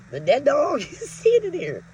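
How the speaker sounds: giggling halfway through the line; a laugh breaks into the words. Retold or imitated. Telling the caller on the phone, laughing.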